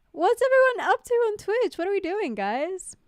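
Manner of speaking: high, loud rallying call; playful calling out. Pretend. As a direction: shout to wake up a sleepy chat. Calling out the quiet Twitch chat.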